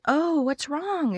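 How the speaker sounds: high, soft, slow worried question; concerned. Retold or imitated. Boss asking the tearful assistant, soft, high and slow. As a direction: gently ask the crying girl what's wrong.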